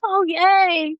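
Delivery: put-on high, bright child's cheer; little daughter's voice. Mother quoting her young daughter, a high childlike cheer. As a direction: chirp it like a happy seven-year-old. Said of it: "And she".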